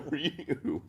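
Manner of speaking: ordinary delivery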